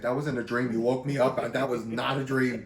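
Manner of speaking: emphatic insistence. Retold or imitated. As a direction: hammer it home that it was real. Friend insists it was real.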